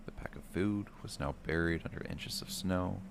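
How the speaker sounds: ordinary delivery, nothing marked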